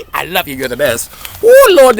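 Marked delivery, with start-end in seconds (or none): 1.4-2.0 high, loud exclaim; loud groan of strain. Real, not acted. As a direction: cry out as the knees hurt.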